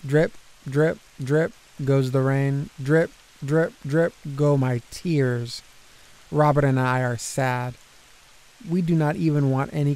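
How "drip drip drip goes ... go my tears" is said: ordinary delivery, nothing marked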